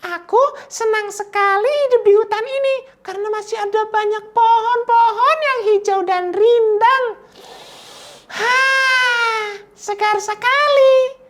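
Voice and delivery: very high, squeaky little-creature voice; high-pitched small animal voice. Shown as a demonstration. Teacher demos a high voice for small animals, squeaky and high. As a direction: squeak it out like a tiny rabbit. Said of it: "Suara dengan nada tinggi seperti apa?".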